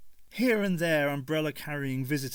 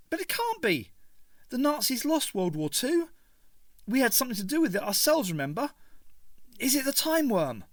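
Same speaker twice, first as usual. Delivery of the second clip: put-on much higher, urgent young voice; Ace, alarmed protest; 11 semitones higher than usual. A narrator's reading. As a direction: refuse to believe it.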